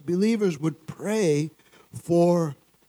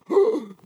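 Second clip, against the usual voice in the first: high, sharp mock gasp; horrified gasp; 12 semitones higher than usual. Man reacting to a stranger's offer of prayer, horrified gasp. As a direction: imitate a shocked intake of breath. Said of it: "what a reaction".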